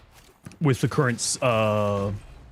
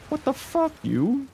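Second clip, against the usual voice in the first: surprised; 12 semitones higher than usual. Real, not acted. Another surprise gift.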